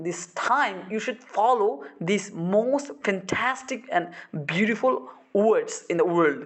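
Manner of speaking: very slow, every word stressed; deliberate, drawn-out emphatic pronunciation; heavily accentuated English. Shown as a demonstration. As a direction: stretch and punch each word for emphasis. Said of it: "মানে emphasis দিছি".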